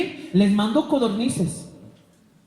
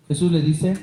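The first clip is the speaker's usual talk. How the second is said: ordinary delivery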